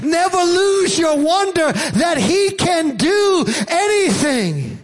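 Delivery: high, slow, stretched-out preaching; impassioned preaching. Real, not acted. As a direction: drive each phrase home with high-pitched fervor. Preaches at full force.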